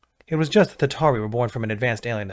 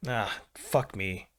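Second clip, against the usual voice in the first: softer and slow, sighing it out; weary sigh; 9 dB quieter, much slower than usual. A narrator's reading. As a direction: let the air go, worn out by the deployment. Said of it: "he sighed".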